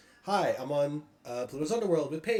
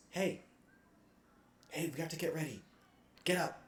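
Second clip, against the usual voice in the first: soft, higher-pitched nudge; gentle waking voice; 4 semitones higher, 6 dB quieter than usual. Acted. Game master voicing someone waking a sleeper, soft and higher. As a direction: rouse a sleeper softly, with pauses.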